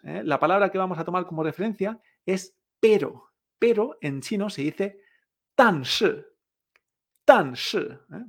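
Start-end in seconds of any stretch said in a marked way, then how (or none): 5.5-7.8 "tan shi" with both syllables falling sharply; two dry blows. Shown as a demonstration. Teacher modelling Chinese for but, "tan shi" as two falling blows. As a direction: strike "tan" and "shi" like two separate shots. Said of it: "Dos golpes secos".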